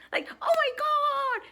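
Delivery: hammed-up shrill squeal of over-excitement. Shown as a demonstration. Speaker mimics over-excited people for an audience, shrill. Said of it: "we get very shrill".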